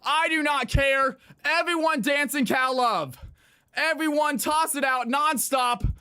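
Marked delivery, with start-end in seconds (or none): none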